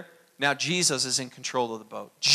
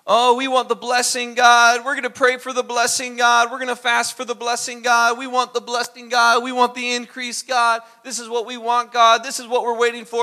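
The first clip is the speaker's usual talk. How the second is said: pleading demand; 6 semitones higher, 8 dB louder than usual. Retold or imitated. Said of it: "mocking a demanding believer".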